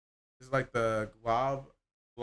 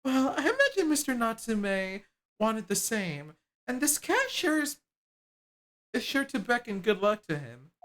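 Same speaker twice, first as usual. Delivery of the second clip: higher, animated character voices; two game characters bickering, voiced higher; voiced game dialogue; 9 semitones higher, 4 dB louder than usual.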